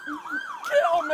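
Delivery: high, desperate cry; desperate plea. Real, not acted. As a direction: beg in despair. Suspect begging in despair.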